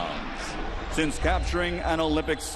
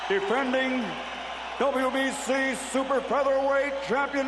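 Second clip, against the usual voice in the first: lucha libre announcer; 9 semitones higher than usual. Real, not acted. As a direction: stretch Barrera into a long high cry. Introducing champion to crowd.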